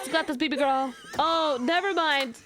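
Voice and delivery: usual speaking voice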